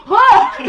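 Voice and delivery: short, loud, high startled yelp; a hammed-up shriek of fright; mock scream. Reacting to Selkie with a fake startled cry. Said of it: "I'm like".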